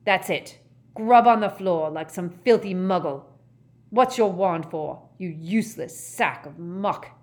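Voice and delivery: loud, scornful scolding; angry berating. A narrator's reading. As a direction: lay into her loudly. Father berates daughter after pot drops.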